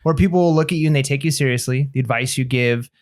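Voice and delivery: normal talking voice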